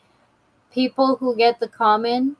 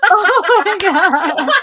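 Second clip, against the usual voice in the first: laughing; 8 semitones higher, 5 dB louder, much slower than usual. Real, not acted. Laughing at funny picture.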